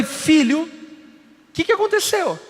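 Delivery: high, startled question to the crying son; rising surprised concern; alarmed father. Retold or imitated. Father finding son in tears, high alarmed question.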